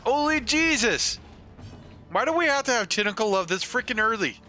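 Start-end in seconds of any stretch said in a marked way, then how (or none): none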